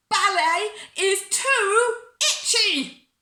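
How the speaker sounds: high, slow, wailing yell; loud complaint. A narrator's reading. Bella interrupts her ballet lesson, complaining very loudly. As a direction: yell it out like a child's tantrum. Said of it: "I was a bit loud".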